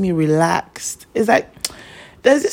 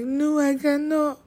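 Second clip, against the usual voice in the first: higher, softer and slow, about to cry; tearful admission; 9 semitones higher, 5 dB quieter, slower than usual. Retold or imitated. Daughter admitting to dad on video call that she lost it, tearful and soft. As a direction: let "No, I cannot" wobble towards tears. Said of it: "And I start crying".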